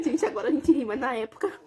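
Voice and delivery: ordinary talk, nothing marked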